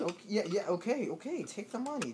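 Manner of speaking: high, wavering giving-in; mock panicked surrender. Man handing a penguin his money, panicky. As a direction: give in with hammed-up nervous pitch swings.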